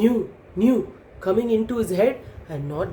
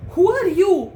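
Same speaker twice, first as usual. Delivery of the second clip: high, louder, lightly acted challenge; questioning baby kangaroo; 10 semitones higher, 5 dB louder than usual. A narrator's reading. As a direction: demand an answer as a little kangaroo. Startled baby kangaroo questions the bird.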